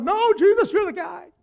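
high, loud burst of loyalty; eager protest. Acted. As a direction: jump in shouting your support. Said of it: "they all would have jumped up".

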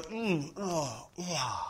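soft satisfied murmurs. Pretend. Sipping sound of satisfied drinker.

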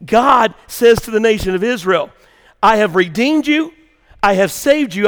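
loud, emphatic pulpit preaching; full-voiced sermon delivery. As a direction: drive the point home to the congregation. Preaching God's words.

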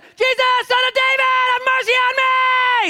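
all-out high scream, last words stretched. Acted. Bartimaeus begging Jesus for mercy, screaming. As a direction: scream the plea with everything you have. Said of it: "And he's screaming".

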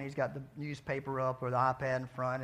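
usual speaking voice

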